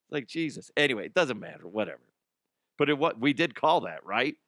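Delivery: usual speaking voice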